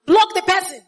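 loud, high order to block; emphatic preacher command; firm command. Acted. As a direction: bark it like a warning. Preacher urging to block sender.

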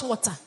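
"water" with a crisp hard t, like "wota"; mother's pronunciation mimicked. Son repeating mommy's mistake, "water" with hard t. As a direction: imitate "water" said with a fully sounded t. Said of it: "you want to speak all the Queen's English".

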